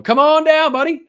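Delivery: very high, loud summons; game show announcer. Pretend. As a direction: belt it out like calling a contestant. Game show call to the boy.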